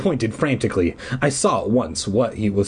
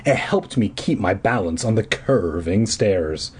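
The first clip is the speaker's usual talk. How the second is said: usual speaking voice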